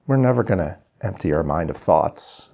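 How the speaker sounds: ordinary talk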